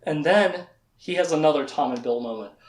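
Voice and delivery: plain reading voice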